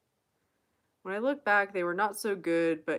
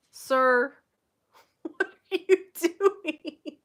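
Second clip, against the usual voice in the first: high pet voice; 11 semitones higher, 10 dB louder, much slower than usual. Real, not acted. Owner scolding her dog in a high, loud pet voice. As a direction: squeal it at the pet with a laugh.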